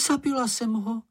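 high, dismayed little voice; horrified Snork Maiden. A narrator's reading. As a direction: wail it out high in shock. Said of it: "vykřikla". (vysoký zděšený hlas)

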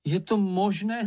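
incredulous exclamation; exclaiming. A narrator's reading. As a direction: cry out in mild disbelief. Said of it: "zvolal Mumínek".

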